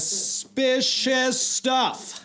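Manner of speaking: drawn-out, high, eerie delivery; ominous. Acted. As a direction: stretch it out like a spooky mystery-show host. Ominous remark on the mystery.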